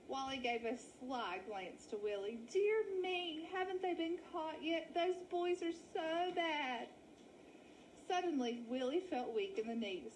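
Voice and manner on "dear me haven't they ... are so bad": high, fluttery dramatic lady; milk the sad lost story; wolf faking a sheep lady. Acted. Wally feigns shock.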